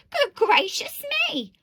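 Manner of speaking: high, loud shocked cry; astonished storybook mother; exclaiming. A narrator's reading. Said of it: "looked into the water and exclaimed".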